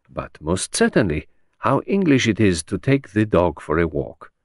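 put-on light French-accented detective; courteous foreign gentleman, amused; Poirot's French accent. Poirot welcoming Henrietta at his door, lightly French.

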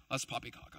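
put-on low, soft British-accented scoff; mock British scoff. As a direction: sniff it out like a stuffy Brit. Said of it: "and they're British for some reason".

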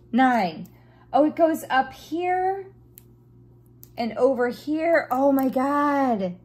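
thrilled while finding the spot; loud excitement. Real, not acted. Placing special drill excitedly.

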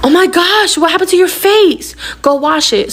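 high, mock-alarmed exclamation; shocked mother. Mother seeing her daughter's scratched face, imitated shock. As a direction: imitate a mom suddenly aghast. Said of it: "my mom kinda, like, snapped out of it".

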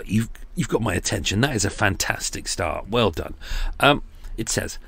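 usual speaking voice

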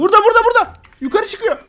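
high, loud alarmed call; excited shouting. Real, not acted. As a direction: shout the warning in panic. Spotting the invisible devil.

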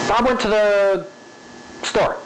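high run-up, long pause before "store"; changed spacing. Shown as a demonstration. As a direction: hold off "store" after "Bob went to the". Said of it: "where you rush".

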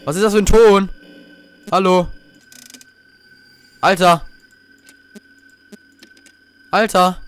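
real rising alarm; frightened calls. Real, not acted. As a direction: cry out at the eerie noise. Frightened by strange sound.

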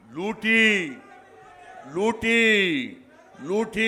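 brand the opponents as looters; loud accusation. Real, not acted. Speaker to rally crowd, loud repeated accusation.

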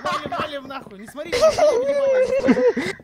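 high loud laughing panic; panicking gamer. Real, not acted. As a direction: shriek and laugh while fleeing. Fleeing, monster near. (паника со смехом)

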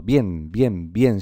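each bien a single beat; one-syllable bien. Shown as a demonstration. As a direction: repeat bien, one stroke of voice each time. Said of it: "Se pronuncia con un solo golpe de voz".

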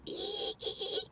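squeal. Pretend. Fingers can't resist the caramel.

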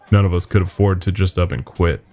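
ordinary delivery, nothing marked

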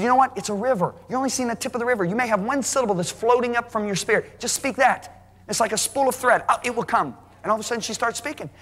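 high, eager encouragement to his wife; excited urging. Retold or imitated. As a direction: coax her on, rising and bright.

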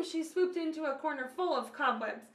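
ordinary delivery, nothing marked

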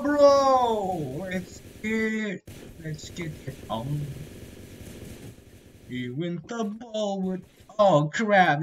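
usual speaking voice

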